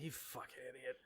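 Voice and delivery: very quiet, low imitated aside; muttered contempt. Jack glances away from March, muttering in disgust. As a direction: mutter it under your breath.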